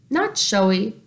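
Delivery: ordinary delivery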